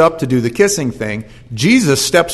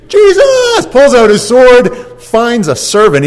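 much higher and louder, slowed, laughing; excited; 9 semitones higher, 6 dB louder, slower than usual. A narrator's reading. Preacher tells church of sword strike, gleefully excited. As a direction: burst out with gleeful excitement, drawing it out.

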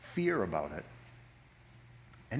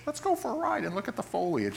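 imitating grandmother; 6 semitones higher than usual. Grandmother quoted inviting foliage ride.

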